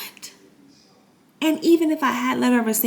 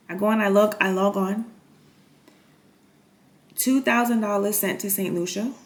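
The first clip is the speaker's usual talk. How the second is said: ordinary delivery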